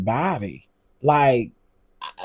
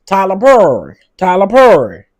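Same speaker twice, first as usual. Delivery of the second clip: loud, raised-pitch call-out impression; Monique; 5 semitones higher, 9 dB louder than usual. Calling out Tyler Perry in a borrowed voice, loud impression. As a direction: belt the name out in a celebrity impression. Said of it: "in my Monique voice".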